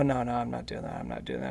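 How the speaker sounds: refusal sinking low and soft; retold protest, dropping away to creaky low; fading refusal. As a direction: trail down into a low mumble of refusal.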